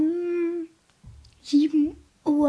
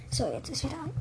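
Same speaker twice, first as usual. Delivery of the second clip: whisper; 8 dB quieter, much faster than usual. Real, not acted. Checking the mic is back.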